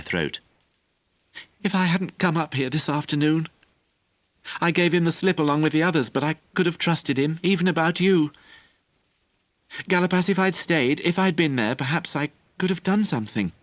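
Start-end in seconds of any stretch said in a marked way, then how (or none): 1.6-13.5 put-on higher, pressing, guilty young voice; a boy blaming himself; upset boy. A narrator's reading. Young Merlin confesses his guilt to Galapas, upset.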